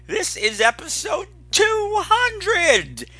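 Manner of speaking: high, slow, showy welcome; excited host welcome. Acted. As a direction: open big for the fans. Host's big opening.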